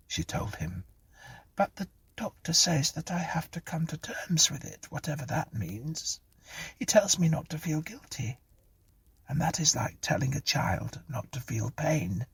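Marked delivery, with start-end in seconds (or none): 1.5-12.2 high, softer woeful complaint; plaintive Clara. A narrator's reading. Voices Clara.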